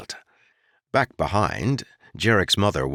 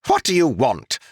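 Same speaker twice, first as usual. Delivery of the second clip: curt, high, louder snap; snapped; 8 semitones higher, 5 dB louder than usual. Acted. Noble snapping at the lumberman, high curt impatient voice. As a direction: cut the peasant off impatiently. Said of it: "he snapped".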